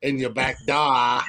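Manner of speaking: mock Boston guy, R's dropped; Boston accent. Co-host imitating a Boston guy's come-on, in a Boston accent. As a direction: do a broad Boston accent on car and door. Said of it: "a guy with a Boston accent".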